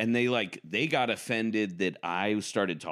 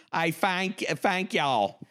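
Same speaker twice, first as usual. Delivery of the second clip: high mock Southern thank y'all; Southern accent; 10 semitones higher, much slower than usual. Comic showing his Southern thank you, mock Southern accent. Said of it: "but in a Southern accent".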